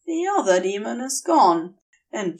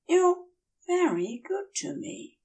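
higher, soft, anxious question; worried Aziraphale; 9 semitones higher, 4 dB quieter than usual. Acted. Aziraphale worriedly asks Crowley, soft and high. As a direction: ask it small and worried.

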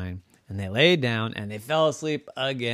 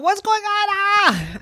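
very high, loud startled cry; startled cat; 6 dB louder than usual. Acted. Cat Purdy jolted awake by the bee, startled cry. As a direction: wake with a fright.

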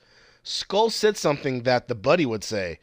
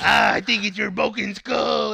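mock villain shout; 6 semitones higher, slower than usual. Mocking Goldar's line to a friend, hammed-up villain shout. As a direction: bellow it like a cheesy monster. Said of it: "And then Goldor's like".

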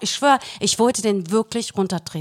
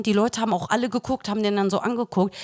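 usual speaking voice

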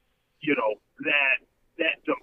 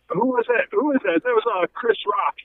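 ordinary delivery

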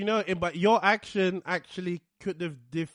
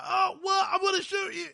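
high shouted mock threat; 11 semitones higher than usual. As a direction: bark it like a cartoon tough guy.

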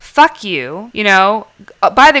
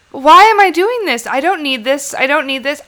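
higher, exasperated self-talk; self-scolding; 5 semitones higher than usual. Retold or imitated. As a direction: snap herself out of it. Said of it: "finally I was like".

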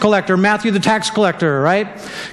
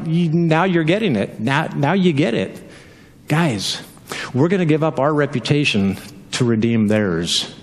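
lower, earnest Jesus voice; voicing Jesus; 8 semitones lower than usual. Preacher voicing Jesus low to his worried disciples. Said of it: "And Jesus is like".

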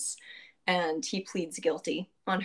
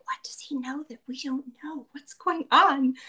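whispering crowd; 5 semitones higher, 4 dB louder than usual. Retold or imitated. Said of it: "the crowd is like".

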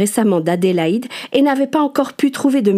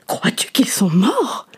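frightened whisper; slower than usual. Acted. Martha fearing the worst.

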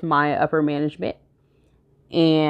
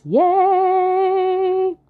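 excited cheer. Acted. Cheerful podcast intro cheer.

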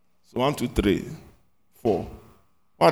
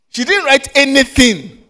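surprised; 10 semitones higher, 6 dB louder, slower than usual. Real, not acted. Teacher exclaims at empty notebook.

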